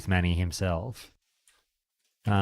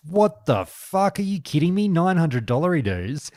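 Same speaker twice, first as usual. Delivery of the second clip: mock outrage; 10 semitones higher, 5 dB louder than usual. Mock shock at the price.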